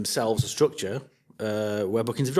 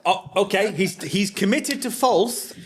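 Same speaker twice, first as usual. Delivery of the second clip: excited teasing; 8 semitones higher, 5 dB louder, slower than usual. Real, not acted. Teasing a quick answer.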